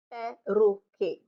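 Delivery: perroquet slowly as pe-rro-quet; French perroquet, each syllable apart; three syllables. Shown as a demonstration. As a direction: break perroquet into three beats. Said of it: "Definitely three syllables there".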